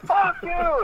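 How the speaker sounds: screaming harder. Retold or imitated. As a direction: yell it out, wild with burning pain. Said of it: "screaming way harder than he was before".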